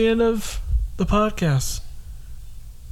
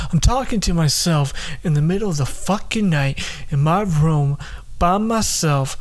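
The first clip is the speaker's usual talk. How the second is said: ordinary talk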